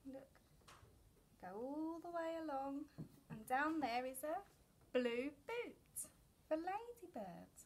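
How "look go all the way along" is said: ordinary delivery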